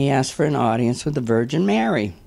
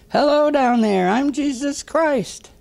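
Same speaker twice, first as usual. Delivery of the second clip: hollering down; 12 semitones higher, slower than usual. Acted. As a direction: call it down harder, voice pitched way up. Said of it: "And he hollers".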